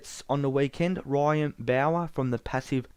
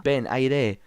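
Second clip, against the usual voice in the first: ordinary talk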